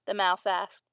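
ordinary delivery

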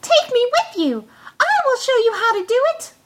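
high, quick little voice; eager to join in, high and quick; tiny Tom Thumb. A narrator's reading. Tom Thumb offers to help the thieves, high and brisk.

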